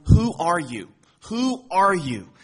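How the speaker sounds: loud, pressing questioner; loud demanding question. Acted. Messengers from Jerusalem pressing John, loud.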